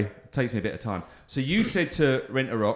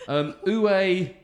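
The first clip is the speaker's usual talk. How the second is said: slow, hammed-up French accent asking "où est travail"; playful mock French accent, slow; 4 semitones higher, 3 dB louder, slower than usual. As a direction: drag it out in a cartoon French accent. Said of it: "very racist French accent".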